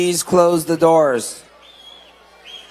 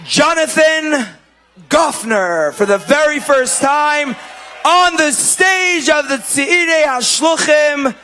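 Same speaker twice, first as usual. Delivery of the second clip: announcer's introduction; 6 semitones higher than usual. Real, not acted. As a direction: call the name out to the whole hall. Emcee announces the entertainer.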